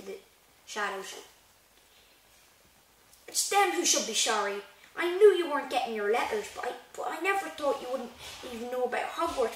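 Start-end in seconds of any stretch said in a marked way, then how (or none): 3.3-9.5 mildly indignant, higher and louder. Acted. Hagrid blaming the Dursleys for hidden letters, indignant. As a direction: protest on the boy's behalf.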